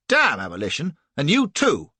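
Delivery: loud furious retort; angry cry. A narrator's reading. Flashman crying out at the captain, angry loud retort. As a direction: spit it back at him. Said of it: "cries I".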